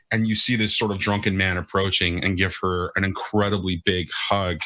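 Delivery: ordinary talk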